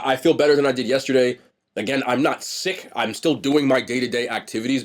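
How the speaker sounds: normal talking voice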